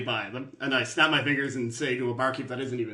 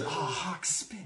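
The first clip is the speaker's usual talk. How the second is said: soft aside, much quieter; under his breath; 11 dB quieter, much slower than usual. Acted. Character handed a spat-in cup, muttering under his breath. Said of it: "Under his breath, he says".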